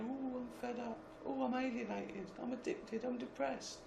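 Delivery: high, soft moaner's voice; put-on feeble moan; mock whinging. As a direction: imitate self-pitying moaners in a high, small whine. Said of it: "people are whinging".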